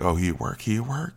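low-key, dropped to near a murmur; hushed mock Jada. Comic voicing Jada covering for Will, hushed. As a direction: keep it secret and hushed. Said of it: "she's like".

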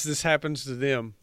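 usual speaking voice

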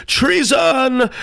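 very high outraged cry; accusing cry. A narrator's reading. Man of the coffin joins the accusation, crying high. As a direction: call it out at top pitch. Assembly echoes the king's shouted accusation.

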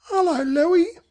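put-on high-pitched little boy's hello; boy greeting baby. Boy greets baby sister in story.

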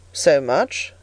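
low, sceptical reply; doubtful. A narrator's reading. Stony stranger hearing the girl's age, mildly doubtful. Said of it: "was the doubtful answer".

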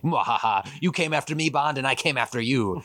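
lightly voiced hammy villain with a mock cackle; mustache-twirling villain. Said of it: "mustache twirling".

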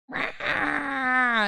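mock baby shout, high-pitched; baby's wail. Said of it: "he's like".

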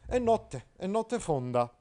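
high, loud, stiff knight's line; Agilulfo declaiming. Acted. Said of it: "ammise Agilulfo". (Agilulfo acuto e forte, impettito)

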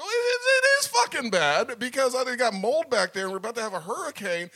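high, loud, exasperated rant; angry outburst. Retold or imitated. Tenant shouting at the maintenance guy, high and loud. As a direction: let the frustration burst out. Angry complaint to the office man.

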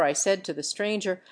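usual speaking voice

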